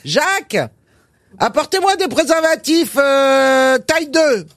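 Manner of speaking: pharmacist calling out. Acted. Pharmacist shouts to her assistant in the stockroom, high call. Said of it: "elle crie à son préparateur".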